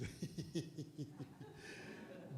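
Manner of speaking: soft unvoiced laughter; breathy laugh. Real, not acted. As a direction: let out a quiet laugh. Laughing at the bills. (risada baixa sem voz)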